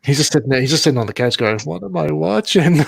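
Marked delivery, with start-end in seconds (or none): 1.6-2.8 high, drawn-out baffled question; mock bewilderment. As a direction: imitate a friend's disbelief, pitched up. Retelling Ben's baffled reaction to film.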